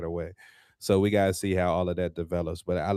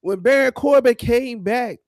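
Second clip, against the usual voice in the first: exasperated outburst; 12 semitones higher, 5 dB louder than usual. Real, not acted. Wrestling podcaster questioning a botched feud, exasperated. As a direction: rant in a high, incredulous voice.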